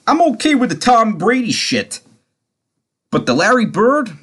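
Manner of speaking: high-pitched, acted-out objection; mock indignant comeback. Tommy squaring up in the bar, mock indignation.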